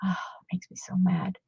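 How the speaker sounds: sighing, then a whispered grumble; hushed irritated whisper under the breath; whispered frustration. Real, not acted. Reading old books, whispering her annoyance.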